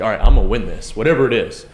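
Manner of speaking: a burst of competitive drive; competitive self. Retold or imitated. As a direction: voice your inner competitor, fired up. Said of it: "my immediate nature is like".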